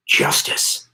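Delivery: breathy unvoiced trailer whisper; whispered movie tagline. Mock trailer voice closing the fake scene, whispered. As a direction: hiss the one word like a film ad.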